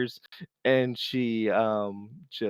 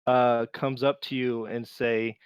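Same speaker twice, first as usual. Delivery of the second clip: ordinary talk, nothing marked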